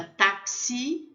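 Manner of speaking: táxi with X said as ks, rising on the last syllable. Shown as a demonstration. Teacher models the letter X, crisp ks. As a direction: say táxi as tak-SI, French style. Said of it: "pronuncia X".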